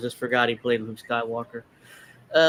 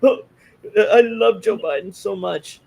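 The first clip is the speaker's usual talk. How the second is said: high, louder, gushing mock adoration; gushing mock fan; 8 semitones higher, 5 dB louder than usual. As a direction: swoon over Biden in a sappy put-on voice.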